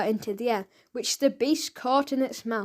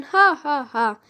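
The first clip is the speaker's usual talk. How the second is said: loud spelled-out ha ha ha; mock laugh; 3 semitones higher, 5 dB louder than usual. A narrator's reading. Mister Big laughing at the boy, loud hammed-up laugh. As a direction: ham up a boss's laugh, loud. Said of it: "Then Fingers started laughing".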